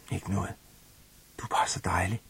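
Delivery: whispered mumble, barely voiced; quiet murmured whisper; mumbled. A narrator's reading. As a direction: mumble it softly into her hair. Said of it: "mumler jeg".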